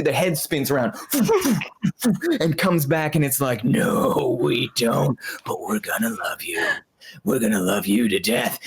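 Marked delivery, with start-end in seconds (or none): 3.6-8.6 low, hushed, sinister threat; menacing whisper. Acted. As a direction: breathe the threat like a horror villain. Sinister back faces.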